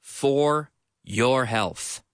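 slow, careful teacher model; each word drawn out for learners; slow model read. Shown as a demonstration. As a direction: lay it out slowly for the class. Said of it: "teacher models the sentence slowly".

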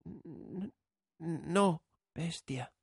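high, stammering, fading refusal; trembling girl voice. A narrator's reading. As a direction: stammer it out, shaking. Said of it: "estremeciéndose".

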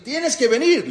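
high, loud mock shout; retold shouting. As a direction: yell the order at her. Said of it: "le empezó a gritar". (grito imitado, agudo y fuerte)

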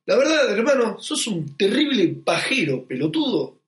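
emphatic insult, slowing on "terrible pajero"; changed, harsher tonality. Acted. Showing how tone makes it an insult, emphatic "terrible pajero". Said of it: "cambiando la tonalidad".

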